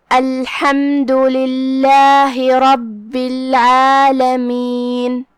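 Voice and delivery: drawn-out, melodic tajweed recitation; measured chanting of scripture; slow Quran recitation. Shown as a demonstration. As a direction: recite each word slowly, with tajweed.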